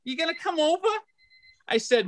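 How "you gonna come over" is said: high-pitched mock mom voice; nagging mother. Comic imitates mom asking him to come over, nagging. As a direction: imitate a mother pestering for a visit. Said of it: "she says".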